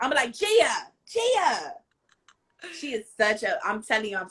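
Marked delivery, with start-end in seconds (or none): 0.3-1.7 high-pitched playful name call. Pretend. Host pretending to call over a friend, playfully calling out. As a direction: sing out the name to summon her.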